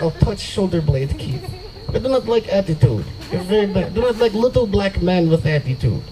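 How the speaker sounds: mock Russian girlfriend voice; Russian accent. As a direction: scold in a put-on Russian accent. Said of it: "a Russian accent".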